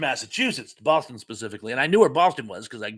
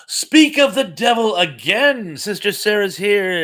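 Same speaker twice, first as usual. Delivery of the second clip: higher, louder, slowed delighted greeting; light up as she walks in; delighted surprise; 6 semitones higher, 8 dB louder, slower than usual. Real, not acted. Host greets Sister Sarah arriving in chat, delighted.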